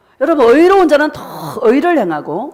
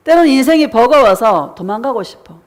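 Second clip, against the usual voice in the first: ordinary delivery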